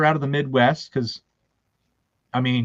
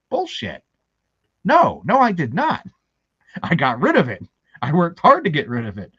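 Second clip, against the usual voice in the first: emphatic mock outrage, pitched up; indignant denial; 3 semitones higher, 3 dB louder than usual. Husband denies wife's claim of an accent, mock-indignant. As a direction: push back hard in pretend protest.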